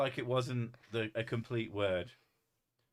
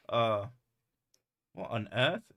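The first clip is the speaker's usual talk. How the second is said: normal talking voice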